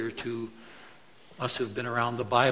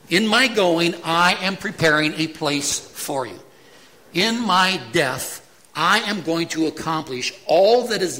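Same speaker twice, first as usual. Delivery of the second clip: firm, emphatic promise, higher and louder; 3 semitones higher, 5 dB louder than usual. Shown as a demonstration. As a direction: stress every I will as certain. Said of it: "These statements are emphatic".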